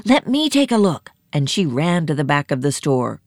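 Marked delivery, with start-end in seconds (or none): 0.0-1.1 higher-pitched call to step in; acted urgent outburst; eager cry. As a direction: cry out, keen to see for yourself. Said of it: "Nancy cried".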